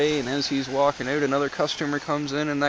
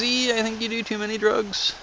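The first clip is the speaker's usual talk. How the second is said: high, puzzled customer voice; 8 semitones higher than usual. Retold or imitated. Customer asking the clerk, high and puzzled. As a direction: squeak it out like the baffled customer.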